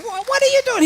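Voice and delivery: imitating his own startled reaction on seeing a friend; surprised. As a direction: caught off guard, squeaking the question. Retelling meeting Robert unexpectedly.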